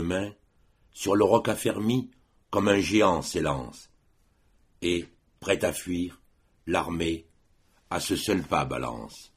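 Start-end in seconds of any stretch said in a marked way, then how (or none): none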